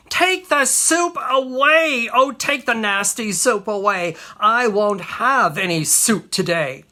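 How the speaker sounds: high, loud tantrum cry; shrieked protest, easing slightly after the start; screamed refusal. Acted. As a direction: yell it like a child refusing. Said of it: "he screamed".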